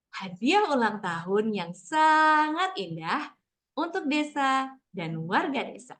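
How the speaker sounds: slow storytelling read with big rises and falls in pitch; expressive intonation. Shown as a demonstration. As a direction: read it to children, stretching the pitch up and down. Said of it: "saya akan coba baca dulu". (membaca dengan intonasi naik turun yang jelas)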